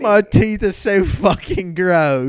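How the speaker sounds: high, slow, loud British wail; drawn-out British caricature; mock British. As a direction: bemoan it in hammed-up British. British caricature about teeth.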